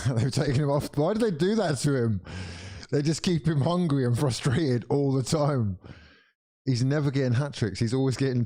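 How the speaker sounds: high, incredulous complaint with a laugh at the start; exasperated protest. Real, not acted. Fan seeing Haaland subbed off, exasperated protest. Haaland substituted before hat trick.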